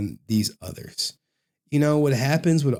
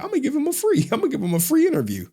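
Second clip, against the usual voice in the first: mocking imitation; 12 semitones higher, much faster than usual. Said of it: "He was like".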